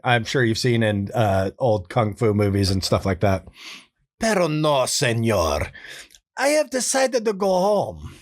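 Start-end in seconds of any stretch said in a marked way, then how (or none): 4.2-8.1 put-on high, pleading dubbed-movie Spanish accent; Spanish accent, like a dubbed film. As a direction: sound like an old Spanish dub. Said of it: "hoarse, like, scratchy voice".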